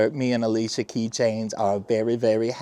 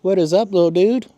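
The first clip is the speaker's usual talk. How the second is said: high, loud pet-greeting voice; baby talk to pet; 9 semitones higher, 5 dB louder than usual. Acted. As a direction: coo up at the cat like baby talk. Greets the cat.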